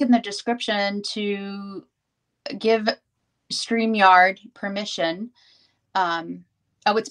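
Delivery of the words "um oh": usual speaking voice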